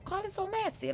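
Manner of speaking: high-pitched playful impression; high put-on New Orleans-style voice; Tokyo. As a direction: pitch it way up, mock-gossipy. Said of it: "Like I said in my best Tokyo voice".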